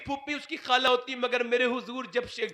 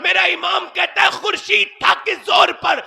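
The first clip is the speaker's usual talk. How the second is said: fiery preaching; 5 semitones higher, 9 dB louder than usual. Acted. Preaching at full force.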